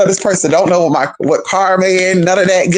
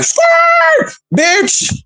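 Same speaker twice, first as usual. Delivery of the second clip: very high shouted skirt sound; mock tire screech shout. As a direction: yell it like tires peeling out. Imitating tires peeling off.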